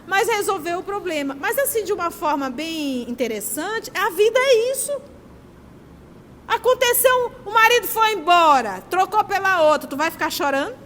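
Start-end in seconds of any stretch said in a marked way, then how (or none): 3.9-9.9 raised, louder emphatic preaching; higher, louder preaching. Acted. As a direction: drive the point home with force. Preacher builds to forceful point.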